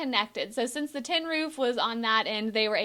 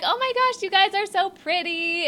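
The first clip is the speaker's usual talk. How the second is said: gushing compliment; 8 semitones higher than usual. Retold or imitated. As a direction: lay the praise on sweetly. Singer complimenting the girls.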